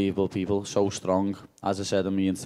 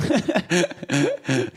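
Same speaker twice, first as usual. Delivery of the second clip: laugh; 6 semitones higher, 3 dB louder than usual. Real, not acted. Laughing at press scrum.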